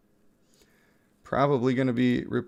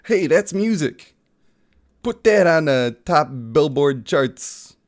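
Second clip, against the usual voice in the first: mock hype; 3 semitones higher, 5 dB louder, slower than usual. Joking praise after the imitation.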